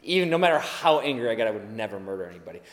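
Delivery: imitating a listener's dismissive thought; mock listener. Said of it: "I know what you're thinking".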